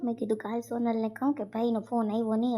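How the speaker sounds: ordinary talk, nothing marked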